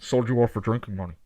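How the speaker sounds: low, deep Kylo Ren mask voice; hollow talking mask. Retold or imitated. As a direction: speak low through a helmet mask. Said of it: "you actually do it pretty well".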